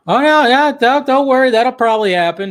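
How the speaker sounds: high, loud hurried reassurance; excited reassurance. Real, not acted. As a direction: jump in to calm the chat, excited. Host reacting excitedly to chat.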